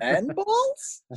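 high, mock-innocent "And balls?"; silly punchline voice. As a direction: blurt it in a high squeaky voice. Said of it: "I just find it hard to say pianist without going".

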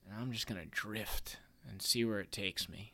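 soft dreamy whisper. Pretend. Whispering his dream of drifting away in a soft whisper.

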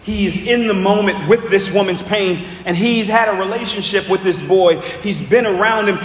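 ordinary delivery

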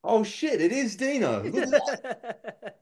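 high, loud, laughing astonishment; half-laughing fake shock, slowing at the end; mock astonishment. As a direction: act stunned while cracking up.

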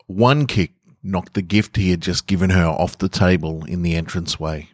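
usual speaking voice

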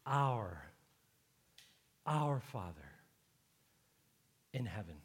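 "Our... our Father... in heaven" with long pauses between phrases; each word emphasized. Shown as a demonstration. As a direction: dwell on "Our", then "Father", leaving silence after each. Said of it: "you emphasize each word".

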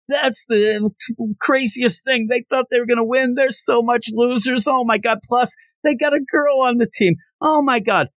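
high-pitched gleeful jeering; mocking taunt. Winning team taunts the losers about their team, high mocking jeer. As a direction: play a team making fun of losers. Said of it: "through this taunting and laughing".

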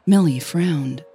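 plain reading voice

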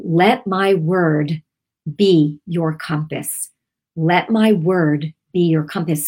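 "Let my word... be your compass", slow with measured pauses; deliberate word emphasis. Shown as a demonstration. Host quoting the Spirit's emphasis, slow and deliberate. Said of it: "said them on the right words".

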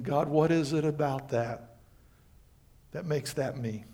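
soft, hushed question to God; quiet prayer. Retold or imitated. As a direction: pray it gently under the breath. Closed Bible and started praying.

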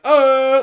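put-on high drunk grunt; a loud, dazed grunt; drunk groan. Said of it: "he's just like".

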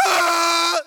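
sudden full-throated cry of Ah; loud shout. Pretend. Preacher to the church, loud joyful shout. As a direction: belt out a joyful Ah. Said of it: "open your mouth and be like".

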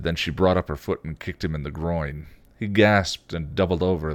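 usual speaking voice

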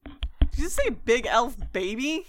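loud, high, slow indignant repeat; mock offended. Elf character taking offence at being called a baby. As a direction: act insulted by the crack.

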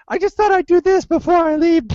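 high, chirpy put-on voice; mock sugary Shelby. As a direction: play her falsely sweet and eager.

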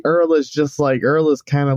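normal talking voice

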